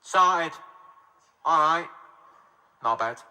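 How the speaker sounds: Mancunian, one phrase at a time; Manchester accent. Shown as a demonstration. Comedian demonstrating three words for Manchester, Mancunian accent. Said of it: "You just need three words".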